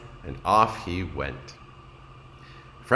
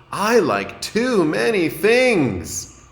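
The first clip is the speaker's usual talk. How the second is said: high, slow and troubled; a worried little turtle who can't choose; higher child voice, slow and a bit lost; fretful young Franklin; 11 semitones higher, 6 dB louder, slower than usual. A narrator's reading.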